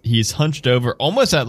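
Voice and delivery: ordinary talk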